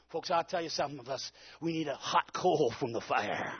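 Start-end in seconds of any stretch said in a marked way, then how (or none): none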